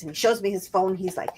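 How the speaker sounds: ordinary talk, nothing marked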